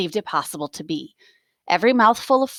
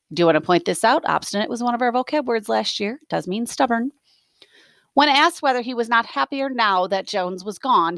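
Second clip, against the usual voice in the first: plain reading voice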